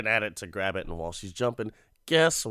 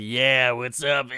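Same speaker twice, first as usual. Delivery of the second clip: slow, loud put-on Santa greeting; drawn-out greeting into a cackle; imitating a leering Santa with a chuckle; creepy Santa; 3 semitones higher, 6 dB louder than usual. Santa answers the daughter, cackling.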